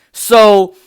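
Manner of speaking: normal talking voice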